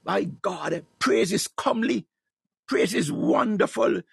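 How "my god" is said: ordinary talk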